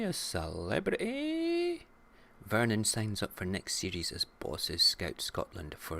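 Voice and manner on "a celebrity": mock show title. Mocking show title high.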